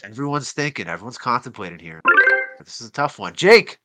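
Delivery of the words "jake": calling out. Real, not acted. Host calls on player.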